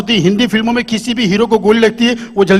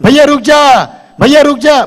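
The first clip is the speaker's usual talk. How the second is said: higher, louder pleading yell; filmy warning shout; 6 semitones higher, 5 dB louder, slower than usual. Retold or imitated. A Hindi film villain shouting a warning, loud pleading call. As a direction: warn him loudly. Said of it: "Hindi फिल्मों में warning होती है".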